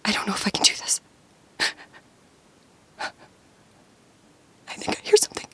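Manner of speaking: frightened breathy whisper; scared whisper. Pretend. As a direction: whisper in mock terror from hiding. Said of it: "I'm scared".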